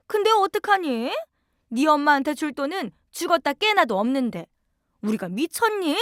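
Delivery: high, sneering scolding voice; scornful mother-in-law. Acted. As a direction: look down on her and scold with contempt. Mother-in-law mocks begging daughter-in-law. (며느리를 깔보며 꾸짖는 시어머니, 높고 비아냥대는 목소리)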